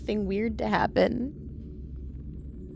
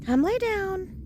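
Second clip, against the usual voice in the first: much higher coaxing voice to the dog; sing-song coax for the pet; pet talk; 11 semitones higher, 3 dB quieter, slower than usual. Real, not acted.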